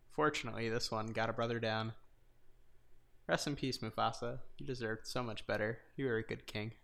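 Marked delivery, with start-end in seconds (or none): 3.3-6.7 subdued, understated mock eulogy; mock solemn. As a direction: pay grave respects, half in jest. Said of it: "My number two is a solemn one".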